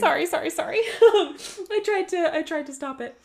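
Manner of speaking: giggling sorry sorry; laughing apology. Real, not acted. Laughing after sneezing on air.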